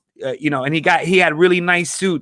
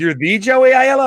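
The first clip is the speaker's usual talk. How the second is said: high, loud astonished question; amazed; 9 semitones higher, 5 dB louder than usual. Retold or imitated. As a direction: ask it as if meeting someone famous. Museum lady repeats her amazed question.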